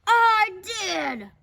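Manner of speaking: loud, booming bark; barked confession. A narrator's reading. Big Bark confesses from above, barking. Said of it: "barked a voice from above".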